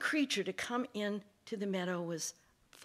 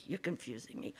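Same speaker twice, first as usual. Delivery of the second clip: much lower and softer echo of "You're confusing me."; soft teasing echo; quiet mocking echo; 8 semitones lower, 9 dB quieter, faster than usual. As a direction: repeat it low and sly. Fox mimics snake slyly.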